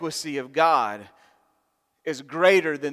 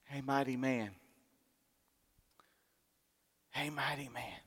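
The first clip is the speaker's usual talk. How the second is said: much softer, gentle calling; tender whisper-soft blessing; 3 semitones lower, 11 dB quieter than usual. Acted. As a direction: speak it gently like a comforting father.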